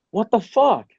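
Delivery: sudden high startled exclamation; shocked outburst. Retold or imitated. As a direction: blurt it out in disbelief. Retold shock at seeing Nerf gun.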